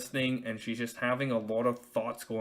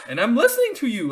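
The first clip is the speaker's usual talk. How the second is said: higher and louder defensive insistence; insistent; 11 semitones higher, 10 dB louder than usual. Retold or imitated. Husband insisting to his wife that he is listening, higher and louder. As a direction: defend yourself, pitched up and pushed. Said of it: "Like, I'm like".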